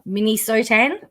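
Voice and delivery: Minnesotan as "minny-SO-tan?", rising at the end; questioning pronunciation. Shown as a demonstration.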